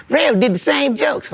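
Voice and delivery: high, slow mocking voice; mock the critic; mocking a hater. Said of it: "had a dude kinda diss me".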